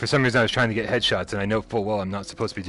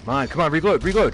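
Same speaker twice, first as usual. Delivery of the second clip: urgent shout, pitch rising; frantic yelling; 6 semitones higher, 5 dB louder than usual. Real, not acted. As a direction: yell at the gun to hurry. Frantic reloading during a fight.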